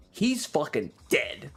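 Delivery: ordinary delivery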